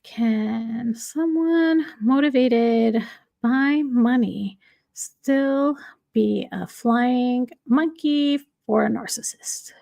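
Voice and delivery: slow, spaced-out words while typing a question; slow typed-out query. Real, not acted. Host types a question about flying monkeys into a search, slowly. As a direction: say it word by word as you type.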